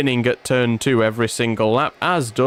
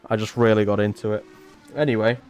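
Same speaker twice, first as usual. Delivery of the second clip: ordinary delivery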